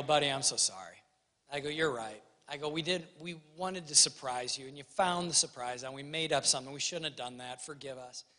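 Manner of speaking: soft, low, fading apology; sincere apology. Retold or imitated. Father kneeling to apologize to his crying son, soft and low. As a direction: apologize gently to a small child. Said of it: "and I go".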